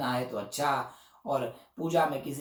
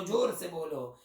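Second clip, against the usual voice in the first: normal talking voice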